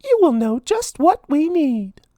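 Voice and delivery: high mouse voice chanting; Minnie's spell. A narrator's reading. Minnie calling on the grinder for food, high chant. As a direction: say the spell hopefully.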